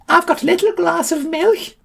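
very high screechy milk seller; piercing high character voice; Frau Milk. A narrator's reading. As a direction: squeal the offer in a very high voice.

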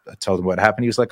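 ordinary delivery

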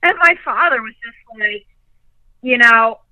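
usual speaking voice

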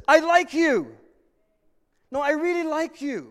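high, loud, gushing declaration of love; eager declaration. Acted. Preacher voicing God, eager and high. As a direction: gush it out like you mean every word.

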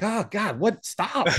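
high, exasperated groan at the ding; mock annoyance. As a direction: act out being annoyed by the notification. Mimics annoyance at phone dings.

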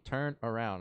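ordinary talk, nothing marked

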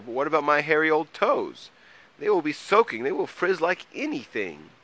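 high, loud fretting; protesting troll. A narrator's reading. Troll frets to Mole about hairy toes, protesting. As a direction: fret loudly, pitched well up.

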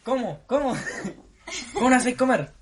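high, loud hammed-up indignation through laughter; laughing mock fury at a chewing diner; mock outrage. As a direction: play Cata outraged at noisy eaters. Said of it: "ya está violenta".

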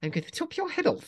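put-on very high, spoilt childish queen; mock tantrum of a spoilt queen; Queenie impression. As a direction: shriek it like a petulant little queen. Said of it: "Queenie in Blackadder".

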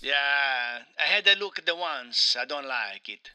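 put-on Italian accent, aggrieved game character; Mario with Italian accent. Mario complains to his interviewer, in a thick Italian accent. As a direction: play an indignant Italian Mario.

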